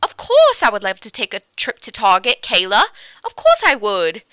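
loud, hammed-up mishmash accent; a joke accent agreeing to a Target run; goofy accent. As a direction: overdo a silly fake accent. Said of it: "we're just doing accents the whole time".

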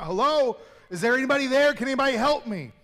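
high, loud cries for help from a cliff; shouting for help. Acted. Man hanging from a branch yells for rescue, loud desperate shouting. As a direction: call out desperately, as if dangling over a drop. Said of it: "He starts shouting out".

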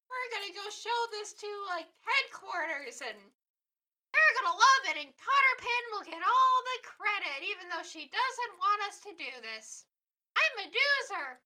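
high, excited little creature voice; Doozer voice. Acted. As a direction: pipe up like an eager little helper. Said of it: "I love your Doozer voice".

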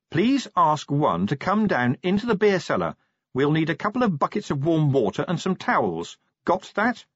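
businesslike officer giving instructions; commanding Angua. Acted.

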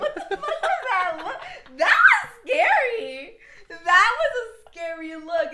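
loud shrieking laugh; laughing shout. Real, not acted. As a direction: yell it through laughter.

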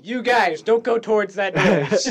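horror movie warning. Pretend. Friend mocks a horror film victim, warning call.

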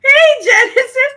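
high squealed greeting through laughter; giddy yell. Acted. Astrid greeting Genesis on the phone, squealing high. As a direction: shriek it out gleefully. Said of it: "Astrid goes".

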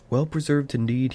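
snorting scorn. A narrator's reading. Bilbo scoffing at talk of being well preserved, snorted. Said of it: "he snorted".

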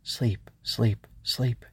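hushed whisper, softly repeated; breath-only whispered self-coaxing; whisper to herself. Acted. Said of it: "Ava whispered to herself".

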